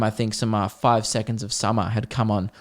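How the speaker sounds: usual speaking voice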